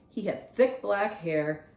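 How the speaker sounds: ordinary delivery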